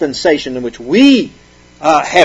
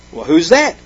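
ordinary talk

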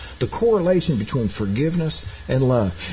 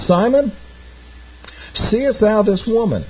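Jesus addressing Simon; 6 semitones higher than usual. Acted.

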